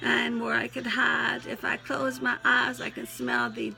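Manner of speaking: animated, twangy verse reading; twangy poem recital. Acted. Poet recalls granddaddy's farm, twangy recital. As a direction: recite it heartily with a country twang.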